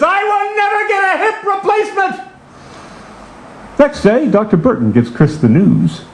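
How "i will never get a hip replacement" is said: high mock cry to the heavens; hammed-up defiant vow; defiant shout. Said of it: "he shouted to the heavens".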